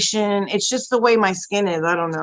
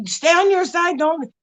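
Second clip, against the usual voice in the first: high, mock-cross warning; imitating own testy, higher-pitched order; retold snapping; 7 semitones higher, slower than usual. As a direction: act out shooing a partner away in pain. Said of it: "I was so evil to him".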